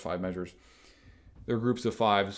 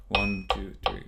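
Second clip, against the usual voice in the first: counting in. Shown as a demonstration. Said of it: "let our metronome go".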